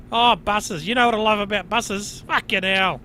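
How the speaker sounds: usual speaking voice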